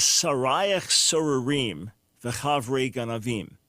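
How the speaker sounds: slow, careful Hebrew reading; Hebrew recited. Shown as a demonstration. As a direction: read the Hebrew slowly, word by word. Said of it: "and says".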